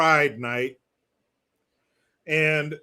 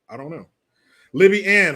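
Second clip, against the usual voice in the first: usual speaking voice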